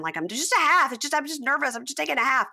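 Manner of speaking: mock nervous excuse. Retold nervous excuse in recap.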